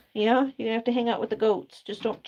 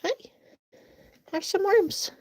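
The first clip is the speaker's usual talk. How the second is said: coaxing pet voice; 9 semitones higher than usual. Real, not acted. Coaxing chickens, higher.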